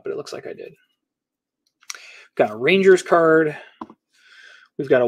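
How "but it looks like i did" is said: muttered aside. Real, not acted. Relieved aside to himself.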